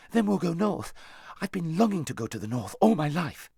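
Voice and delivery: put-on high, loud boyish delight; jubilant, eager outpouring; excited boy. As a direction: burst out joyfully, pitched right up. Boy overjoyed at going north.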